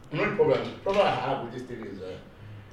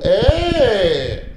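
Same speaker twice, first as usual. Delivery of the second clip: higher, louder mock surprise; 6 semitones higher, 9 dB louder than usual. Pretending shock to his niece about the internet, mock exclamation. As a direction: fake an innocent shocked outburst.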